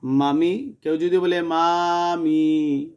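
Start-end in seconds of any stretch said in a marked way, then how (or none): 0.0-0.7 মামি read quickly, short vowels kept short; quick correct reading. Shown as a demonstration. Teacher reads মামি the right way for students, quick. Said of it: "আপনি তাড়াতাড়ি পড়বেন". 1.4-2.9 মামি stretched slowly, vowels dragged long; wrong drawn-out reading. Shown as a demonstration. Teacher demonstrates students' wrong slow মামি, dragged out. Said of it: "তাহলে ভুল হবে".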